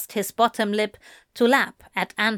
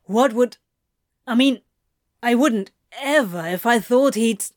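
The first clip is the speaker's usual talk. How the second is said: flustered; 5 dB louder than usual. Acted. Flustered stammering denial.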